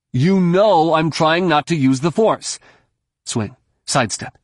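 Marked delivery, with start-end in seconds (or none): 0.1-2.6 raised, higher-pitched protest; urgent objection in a lifted voice; heated retort. A narrator's reading. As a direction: argue back over the fighting. Jason arguing back mid-battle.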